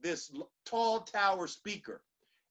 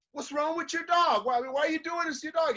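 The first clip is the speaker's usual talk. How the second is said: high-pitched, agitated questioning; raised pitch, urgent puzzled questions; anxious passerby; 7 semitones higher, faster than usual. Acted. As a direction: act out a troubled passerby demanding an explanation. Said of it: "he's just getting this anxiety inside him".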